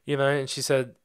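ordinary talk, nothing marked

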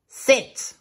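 high sharp "Sit"; high bark of a title command. Acted. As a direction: bark it like a dog trainer.

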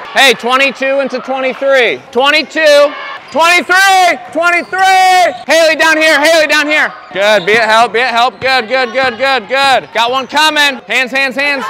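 loud, high coaching yells across the court; sideline shouting. Real, not acted. Coach yelling instructions to players on the court, loud. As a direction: call the plays out over the crowd.

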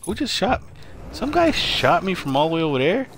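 high-pitched, indignant squeal of disbelief; outraged disbelief. Real, not acted. Gamer sniped from across the map, outraged high disbelief. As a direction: yelp it like you can't believe you got sniped. Shot from far away, outraged.

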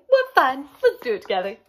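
loud, gleeful opening, settling after; a pollywog delighted to join in; happy. A narrator's reading. Pollywog invites caterpillar to change together, happy. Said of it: "the pollywog said happily".

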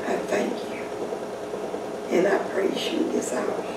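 unvoiced, hushed thanks; whispered. Real, not acted. Praying, whispering quiet thanks and praise.

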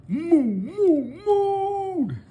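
mooing cows. Acted. Teacher voicing excited cows for the kids, mooing. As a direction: sing out the cow moo.

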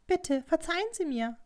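high, meek apology; apologetic plea. Acted. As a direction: beg forgiveness of a superior. Servant girl begs forgiveness.

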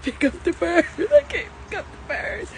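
real high-pitched tearful wail; crying. Real, not acted. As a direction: wail it helplessly through tears. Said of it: "why is this making me cry".

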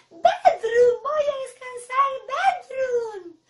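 grito feliz, muy agudo y fuerte. Shown as a demonstration. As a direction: chilla de alegría exagerada. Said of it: "A ver, felices". (feliz, a todo pulmón)

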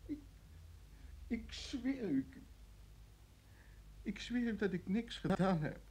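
hushed, halting plea of innocence; frightened pleading. Acted. Prisoner to his interrogator, hushed and scared. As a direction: swear it, scared and broken.

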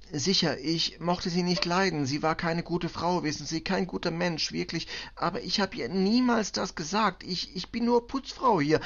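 high, agitated protest in halting foreign German; distraught cleaning woman. Acted. Cleaning woman denies planning the killing, desperate and high.

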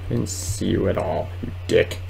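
normal talking voice

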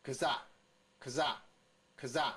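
kza slowly repeated, short k into stressed za; sound drill. Shown as a demonstration. Teacher models the next sound on the list, sound drill. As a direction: separate takes of kza, weight on za. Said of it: "下面是".

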